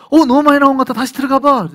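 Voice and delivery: a sly devil calling the deacon back in; tempting devil. Acted. 사탄이 다시 들여보내는 장면. (사탄이 집사를 부르는, 크고 높은 능청스러운 목소리)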